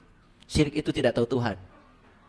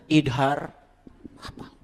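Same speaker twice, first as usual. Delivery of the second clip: ordinary talk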